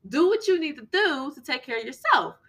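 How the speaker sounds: urging, pitch up high and fast; high emphatic insistence. Real, not acted. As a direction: drive the point home, voice climbing. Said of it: "Excuse the language".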